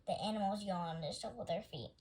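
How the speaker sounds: ordinary delivery, nothing marked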